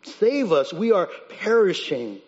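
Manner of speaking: loud, urgent cry; exclaiming plea. Acted. Disciples crying out to Jesus, urgent plea. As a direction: exclaim for rescue. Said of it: "They're just exclaiming".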